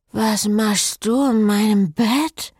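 slow, high, sleepy woman's voice; groggy woman. Acted. Cécile waking on the priest, slow and groggy. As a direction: drag it out like just waking.

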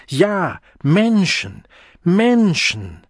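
exclaim. A narrator's reading. Begeisterter Ruf im Kampf.

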